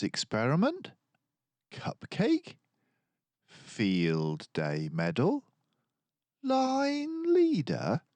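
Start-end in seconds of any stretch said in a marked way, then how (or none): 1.7-2.6 high disbelieving rise on Cupcake; incredulous. A narrator's reading. Narrator listing what Boris missed, incredulous. 6.4-8.0 high, slow disbelieving question; incredulous. A narrator's reading. Narrator listing what Boris missed, amazed.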